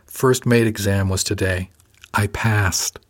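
plain reading voice

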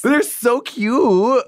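high, slow gush. Real, not acted. Host swoons over British people, slow high gush. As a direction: stretch it out, swooning.

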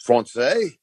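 Français said in French, rising; surprised Français? with French vowels; wife asking in French. Retold or imitated. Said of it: "She didn't speak English at all".

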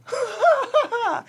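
high amused huff of a laugh; laughing huh. Real, not acted. Laughing after money shock.

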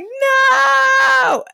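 high, loud horrified no; shouted no. Retold or imitated. Viewer shouting at the sheriff's gun, a loud no. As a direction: yell at the screen to stop it. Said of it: "And you're like".

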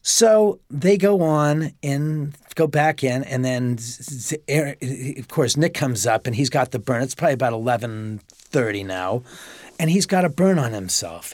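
normal talking voice

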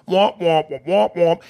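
muffled trombone-like womp womp; Charlie Brown adult voice. Retold or imitated. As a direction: mimic the garbled cartoon teacher. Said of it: "sounds like the adults from the Charlie Brown cartoon".

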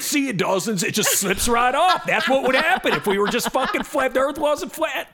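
rising to a high exclamation; mock exasperated demonstration. Host acting out a coin-on-ball demonstration, exasperated. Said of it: "and he's like".

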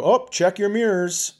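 high, light passing remark; breezy quip. Retold or imitated. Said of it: "thinking that was kind of a nice thing".

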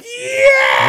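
very high loud mock cheer; hammed-up fan yell; crowd cheer. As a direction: whoop like a wrestling crowd. Fans cheering in pitch.

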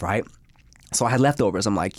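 ordinary delivery

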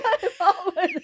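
high laugh breaking through the words; can't finish for laughing. Real, not acted. Laughing while retelling.

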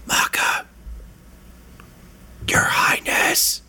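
unvoiced whisper, slow and drawn out; whispered call. Sneaking gnome whispering for the party. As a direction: whisper the names while sneaking. GM voices sneaking NPC calling.

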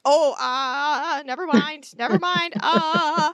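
mock-embarrassed backpedalling, pitch climbing; flustered judge. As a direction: play a squeamish judge hastily dropping it. Voicing the embarrassed judge backing off.

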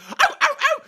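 high, loud mock yelps of pain. Picturing the dog destroying the living room, loud mock yelps. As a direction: yelp as if bitten, hammed up. Dog galloping wrecks things.

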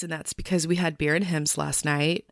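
usual speaking voice

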